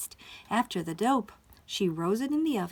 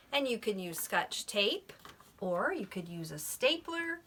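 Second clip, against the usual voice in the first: plain reading voice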